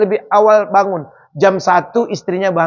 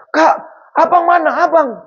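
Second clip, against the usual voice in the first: urgent asking; 8 semitones higher than usual. Retold or imitated. Visitor urgently asking for husband.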